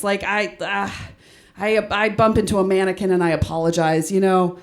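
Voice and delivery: normal talking voice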